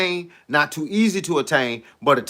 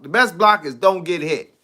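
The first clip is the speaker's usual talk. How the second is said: ordinary talk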